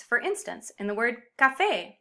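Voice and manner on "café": ca-FÉ, final syllable stressed; stress on accented syllable. Shown as a demonstration. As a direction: put the weight on the "fé" of "café". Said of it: "signal where the emphasis or stress belongs".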